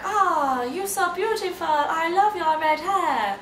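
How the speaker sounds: woman in a shop gushes over her; gushing woman. Retold or imitated. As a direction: coo over her red hair. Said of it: "she was like".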